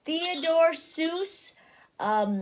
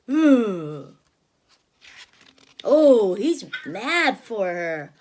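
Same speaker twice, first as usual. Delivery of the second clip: plain reading voice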